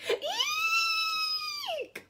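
piercing high squeal of fright; shriek. Acted. Lady startled at midnight, shrieking high. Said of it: "she opened them and shrieked".

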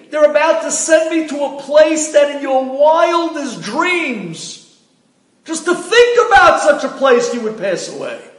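husband in a dream shouting at his wife, terrified; screaming at the top of his lungs. Retold or imitated. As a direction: shout it down at her, desperate. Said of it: "screaming on the top of his lungs".

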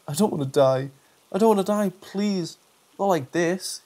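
pleading, voice climbing into panic; desperate pleading. Acted. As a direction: beg for your life. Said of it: "he pleaded".

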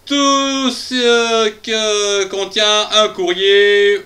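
"tout ce que" stretched very slow and high; drawn-out emphasis. Acted. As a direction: drag out "tout ce que" syllable by syllable. Vows to read everything next time.

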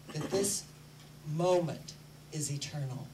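ordinary delivery

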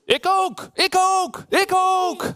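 very high, squeaky me-too cries; high-pitched storybook animal voices; eager animal clamour. A narrator's reading. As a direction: pipe up excitedly as clamouring animals. Dog and deer shout in story.

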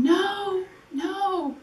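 high re-enacted cry of grief; crying out, retold. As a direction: wail it lightly, pitched well up, as a remembered cry. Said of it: "falling on his shoulder and just crying".

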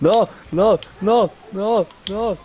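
high repeated no no in disbelief; shocked denial. Real, not acted. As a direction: cry out no no at the goal. Disbelief as goal goes in.

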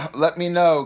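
normal talking voice

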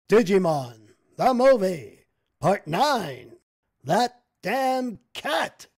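loud, booming title read; movie trailer announcer. Pretend. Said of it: "mock movie title announcement".

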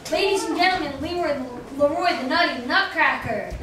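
loud showman introduction; announcer voice. Acted. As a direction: announce the act grandly. Introducing next puppet.

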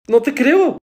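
high, loud refusal to believe; shocked disbelief. Acted. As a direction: reject the news in shock. Acted shock at bad news.

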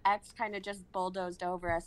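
ordinary delivery

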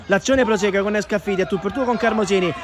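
ordinary delivery

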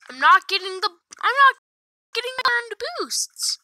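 high-pitched, agitated complaint; real rising frustration, pitched high; agitated protest. Real, not acted. Notices boosts are missing.

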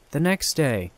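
ordinary delivery, nothing marked

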